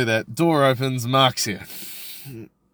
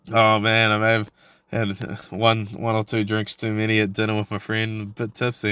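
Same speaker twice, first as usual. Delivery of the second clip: ordinary talk, nothing marked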